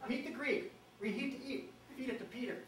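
playful bird-song mnemonic, a touch of laughter; tanager mnemonic. Shown as a demonstration. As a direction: whistle the words like a tanager. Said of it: "That's how you know the summer tanager".

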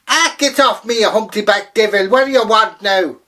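high, loud outraged cry; a grabbed leprechaun yelling at the old woman; leprechaun shouting. Acted. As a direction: yell at her like a caught leprechaun.

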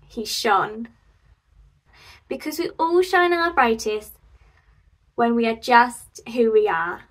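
usual speaking voice

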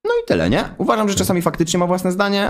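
ordinary talk

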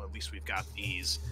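a soft mock whisper of resignation; hushed, half-whispered line; whispered aside. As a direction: breathe it out, barely voiced. GM voices McWhirr's quiet remark.

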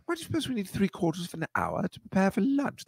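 put-on British accent. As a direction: imitate a very proper English child. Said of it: "but they said".

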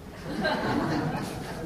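high breathy giggle; laughing. Real, not acted. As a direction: break into laughter. Laugh during retelling.

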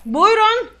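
loud, high summons; calling out. Pretend. Officer calling the next traveller, loud call. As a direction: call the next one up loudly.

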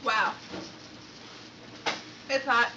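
loud, high, pained from chili heat; desperate for milk, mouth on fire; mouth burning from spicy food. Real, not acted. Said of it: "My lips are burning".

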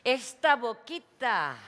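slow, loud, drawn-out tut; mock scolding. [name] mockingly scolds María's foul mouth, slow and loud. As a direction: chide her like a naughty child. Scolding after sister swears.

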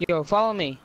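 ordinary delivery

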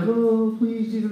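small, soft mock plea; mock polite pleading. Preacher imitating a mild tearful prayer, softly pleading. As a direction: plead daintily, barely crying. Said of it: "we're not just talking about".

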